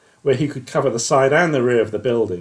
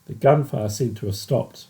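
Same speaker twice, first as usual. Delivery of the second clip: ordinary delivery, nothing marked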